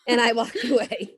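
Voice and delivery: laughing. Real, not acted.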